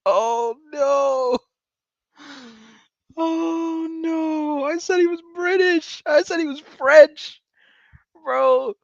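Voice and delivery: high-pitched, loud, laughing cries of disbelief; shrieking disbelief. Real, not acted. Streamer shrieking at his own mistake, laughing high and loud. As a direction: squeal in shocked amusement at being so wrong. Streamer shocked he was wrong.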